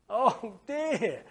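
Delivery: weary groan breaking into a laugh; laughing sigh. Acted. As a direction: sigh through a giggle. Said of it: "says Mister Lazy".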